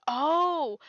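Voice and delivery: sudden "Oh!" of realisation; surprised. Real, not acted. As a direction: caught by a new idea.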